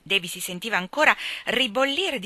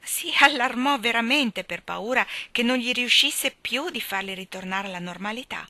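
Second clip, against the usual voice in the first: ordinary delivery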